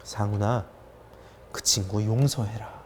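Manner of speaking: low, soft, measured command; hushed, grave instruction, dropped in pitch; voice of God. Retold or imitated. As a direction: speak as God, quietly and slowly. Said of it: "딱 한마디 하셨습니다".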